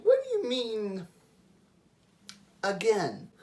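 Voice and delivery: high, disbelieving "what do you mean"; incredulous question. Retold or imitated. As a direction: act baffled, voice jumping up. Retorting to her boyfriend's claim.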